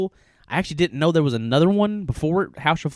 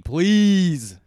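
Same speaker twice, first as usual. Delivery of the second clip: pleading; 5 semitones higher, 5 dB louder than usual. Real, not acted. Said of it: "Put the fuck out Muzaney".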